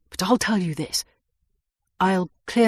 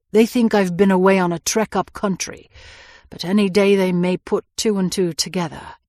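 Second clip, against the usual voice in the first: ordinary delivery, nothing marked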